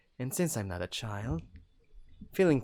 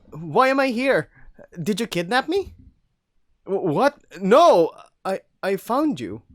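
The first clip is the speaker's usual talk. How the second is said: agitated; 10 semitones higher, 13 dB louder than usual. Acted. Heated dialogue.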